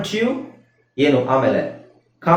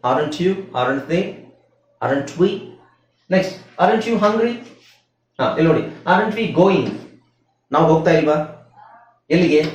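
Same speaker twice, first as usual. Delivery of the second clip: ordinary talk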